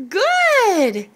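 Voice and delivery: loud, high, drawn-out cheer; big, proud burst of praise; delighted praise. Real, not acted. Parent praising toddler for saying the word, delighted.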